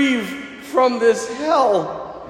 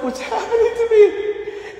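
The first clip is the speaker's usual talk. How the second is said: much higher, half laughing panic; panicked squeal; 12 semitones higher than usual. Retold or imitated. Sick man doubled over in bed, high comic panic. Stomach cramps in the night.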